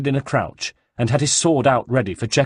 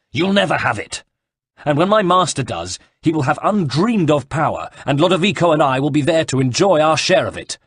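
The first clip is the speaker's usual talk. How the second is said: higher-pitched, defiant villain; heated defiance; 5 semitones higher, 4 dB louder than usual. A narrator's reading. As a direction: defy your opponent with heat. Villain defies opponent in sword fight.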